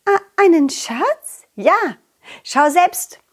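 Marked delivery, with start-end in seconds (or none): 0.0-2.0 put-on surprised butterfly girl.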